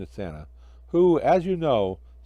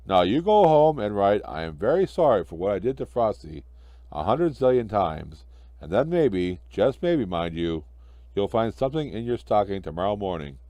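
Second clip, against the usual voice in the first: low, firm Santa scolding; stern Santa; 4 semitones lower than usual. Acted. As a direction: lay down the law kindly.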